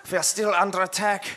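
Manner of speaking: put-on earnest, comic German accent; rally them in German-accented English; stagey German accent, building in pitch. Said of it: "say in a German accent".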